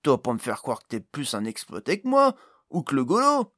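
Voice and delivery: loud indignant character protest; acted protest rising to a high shout. A narrator's reading. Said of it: "protesta Jason".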